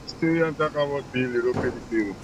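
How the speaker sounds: faint, distant caller audio; phone held away. Real, not acted. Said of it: "I can't hear you".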